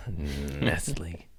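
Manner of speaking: whispered, hissed name of the company. Real, not acted. Host whispering the hated company's name like a curse.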